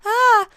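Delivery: high, loud giddy squeal; loud high-pitched flustered ah. Retold or imitated. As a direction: squeal it like a smitten teen. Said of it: "but more nervous in".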